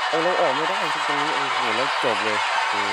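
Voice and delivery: high, loud cries of dismay; panicked yelling. Real, not acted. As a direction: shout it out as the trap closes. Trapped, game over.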